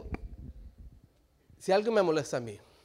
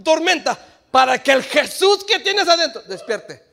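loud, high preaching shout; 11 semitones higher, 16 dB louder than usual. Acted. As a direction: cry it out to the church. Preaching at full force.

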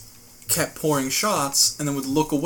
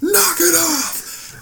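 retold scream; 13 semitones higher, much slower than usual. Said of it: "Ted just screamed down".

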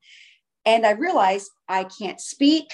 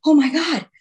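spent exhale, then a loud, higher gasped exclamation; breathing heavy; 5 semitones higher, 5 dB louder than usual. Retold or imitated. Teller re-enacts release after the struggle, breathing heavy. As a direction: act winded after a fight. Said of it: "breathing heavy".

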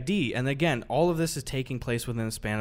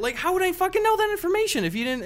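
high, loud incredulous retort; high incredulous mock outrage; exasperated retort; 14 semitones higher, 4 dB louder than usual. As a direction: throw the question back, disbelieving. Retelling angry retort to cashier.

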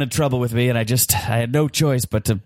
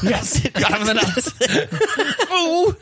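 laughing gloat; 8 semitones higher, slower than usual. Acted.

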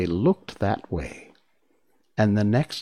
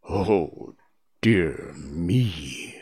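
whispered dismay; 4 semitones lower, much slower than usual. Acted. Hidden Oz is dismayed.

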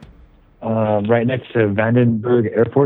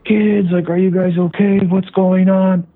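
worried parent call; 10 semitones higher, slower than usual. Retold or imitated. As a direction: call out to the kids in alarm. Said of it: "my dad and mom immediately just like".

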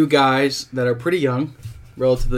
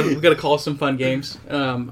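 ordinary talk

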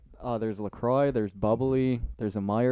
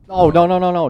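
loud protest; 6 semitones higher, 10 dB louder than usual. Real, not acted. Objects to reading the Walmart brand.

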